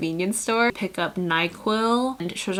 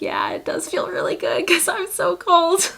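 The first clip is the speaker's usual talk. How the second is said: much higher, squeaky retelling; high squeal; 10 semitones higher than usual. Retold or imitated. Freezing sister answering her overheated sister, high mock squeal. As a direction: act out a high-pitched reply. Acts out reply while freezing.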